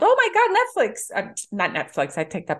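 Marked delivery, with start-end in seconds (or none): 0.0-1.1 much higher, excited exclamation; excited yell. Retold or imitated. Family recognizing the actor, yelling excitedly. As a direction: blurt out in recognition. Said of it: "everybody in my house immediately yelled".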